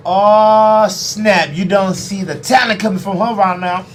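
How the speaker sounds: loud, high admiring call; excited praise for her talent; excited hype. Real, not acted. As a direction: hype up the artist. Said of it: "hyping the artist".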